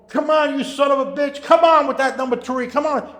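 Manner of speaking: high, loud agitated gambler cursing; mock yell down the phone at a race; imitated screaming. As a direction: urge the horse on, loudly imitating a screamer. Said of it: "Angel's screaming on the other end".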